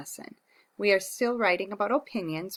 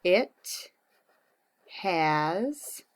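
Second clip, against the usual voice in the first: words spaced out while writing; slow dictation; much slower than usual. Shown as a demonstration. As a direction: say each word as you write it. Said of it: "so I like the water park because".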